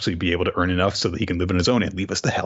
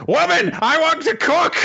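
high, loud character outburst; mock yell; 5 dB louder than usual. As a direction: yell it as an over-the-top demand. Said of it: "he just yells".

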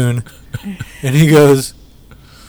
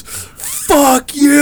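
put-on drunk shout; 12 semitones higher than usual. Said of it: "Brendan goes".

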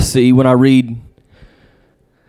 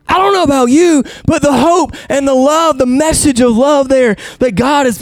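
much higher, fervent preacher at full force; impassioned preaching; 13 semitones higher than usual. Acted. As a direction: lift the room with hope and love. Preaching with rising passion.

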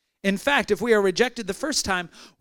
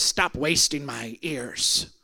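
lower, slow, grumbling dismissal; grumpy old man; 7 semitones lower, 4 dB quieter, much slower than usual. Retold or imitated. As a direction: brush him off like a cranky old man. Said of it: "He goes".

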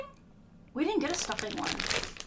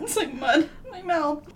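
laughing disgust; 6 semitones higher, 4 dB louder than usual. Real, not acted.

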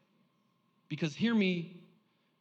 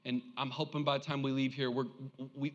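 ordinary talk

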